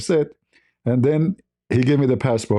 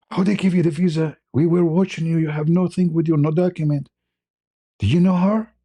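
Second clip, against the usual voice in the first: higher-pitched incredulous questioning; mock disbelief from the crowd; retold amazement; 4 semitones higher than usual. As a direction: quiz him as a baffled onlooker. Amazed applicants question him.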